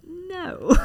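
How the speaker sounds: high-pitched retold no; high little no, laugh right behind it; mock refusal. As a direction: squeak out a playful refusal. Retelling her refusal of oysters.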